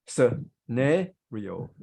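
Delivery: "scenario" split slowly as suh, NAIR, ee-oh; slow American-style suh-NAIR-ee-oh; American pronunciation. Shown as a demonstration. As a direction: break up "scenario", stressing an "air" second syllable. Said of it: "American accent, you can say".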